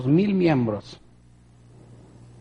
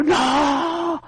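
desperate shout; 11 semitones higher than usual. Retold or imitated. Preacher shouting after the departing Lord, desperate high yell. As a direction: yell it out toward someone leaving.

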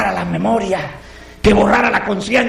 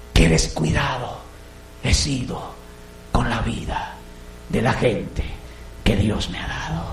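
low, soft self-reproach, halting; quiet remorse; 9 semitones lower, 7 dB quieter than usual. Acted. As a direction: murmur the regret to yourself. (remordimiento en voz baja)

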